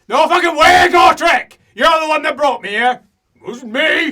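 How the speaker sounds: high, full-volume shouted denial; furious protest. Acted. Character refusing and blaming Gotrek, furious shout. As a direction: yell the blame straight back.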